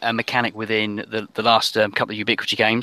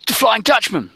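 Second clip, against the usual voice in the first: high, loud exclamation; alarmed sailor's cry; 12 semitones higher, 4 dB louder than usual. Acted. Sailor naming the ghost ship to the musketeers, alarmed cry. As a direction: call it out like a frightened sailor. Sailor answers player about the boat.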